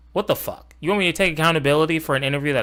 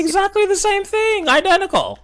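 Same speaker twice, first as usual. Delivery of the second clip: high, louder sarcastic agreement; mock agreement; 5 dB louder than usual. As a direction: overdo the agreement mockingly.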